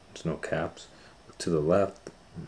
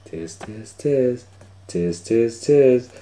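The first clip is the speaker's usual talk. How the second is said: monkey chatter getting louder; 6 semitones higher, 9 dB louder, slower than usual. A narrator's reading.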